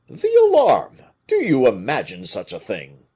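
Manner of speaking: a startled giant, high and loud; astonished. A narrator's reading. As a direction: blurt it out in disbelief. Porthos astonished at order.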